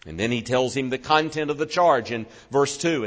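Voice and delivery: normal talking voice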